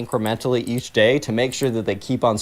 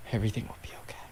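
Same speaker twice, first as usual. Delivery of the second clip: unvoiced whisper of Everything will be okay; whispered mantra; 4 semitones lower, 16 dB quieter, much faster than usual. [name] repeats to himself in a hushed whisper, Everything will be okay.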